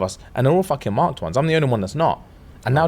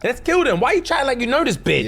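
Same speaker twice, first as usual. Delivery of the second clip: mock outraged Violet; 10 semitones higher than usual.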